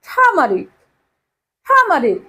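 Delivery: টারমারিক with silent r, "thaa-maa-rik". Shown as a demonstration. Teacher modelling turmeric for students, টারমারিক without the first r. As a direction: say টারমারিক with aspirated t and no r before m. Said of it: "আর এর উচ্চারণ হবে না".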